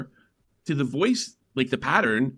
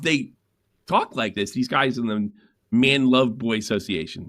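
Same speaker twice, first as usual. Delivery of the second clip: ordinary talk, nothing marked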